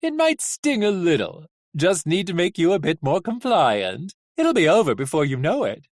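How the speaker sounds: lilting, swooping villain voice; theatrical mock reassurance; gleeful coachman. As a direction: make light of the pain, showily. Coachman gleefully warns before shocking.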